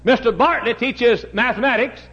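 high, loud revival preaching; full-force preaching. Acted. Preacher explaining the deacon board to the church, loud and high.

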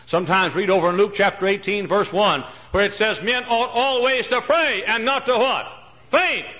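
high, loud preaching cry; ringing pulpit shout; full-force preaching. Acted. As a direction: call the verse out to the crowd. Preacher quoting Luke at full force.

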